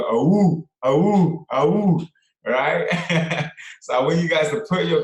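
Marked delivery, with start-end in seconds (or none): 0.0-2.1 high, loud "awoo" howls; howling like a wolf pup. Retold or imitated. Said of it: "when I saw to go".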